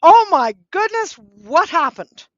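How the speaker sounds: loud, high, gasping alarm, slowing on happened; bombastic alarm. Shown as a demonstration. To a grieving friend, a loud bombastic shocked outburst. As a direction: blurt it out with big theatrical shock. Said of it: "bombastic state of it's loud and it's, it's assertive".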